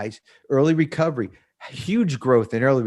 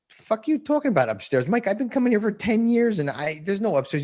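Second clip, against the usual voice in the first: higher, mock-disbelieving comeback; incredulous retort; 10 semitones higher than usual. To his friend in the studio, a higher incredulous scoff. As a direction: scoff at the idea of an upstairs. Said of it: "I'm like".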